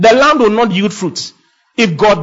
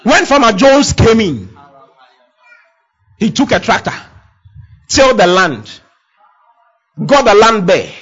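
higher, louder preaching of the parable; preaching at full force; 6 semitones higher, 4 dB louder than usual. Acted. Preacher pressing the farmer parable on his listeners, rising fervour. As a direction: drive the farm story home to the congregation.

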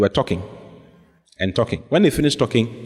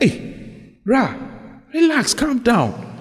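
calming down; 10 semitones higher, much slower than usual. Retold or imitated. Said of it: "I was like".

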